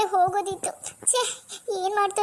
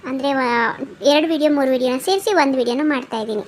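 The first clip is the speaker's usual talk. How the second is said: louder, upbeat appeal to viewers; channel announcement; 8 dB louder than usual. Real, not acted. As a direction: talk straight to subscribers, asking for support. Creator addresses viewers. (ವೀಕ್ಷಕರಿಗೆ ಜೋರಾಗಿ ಉತ್ಸಾಹದಿಂದ ಮನವಿ)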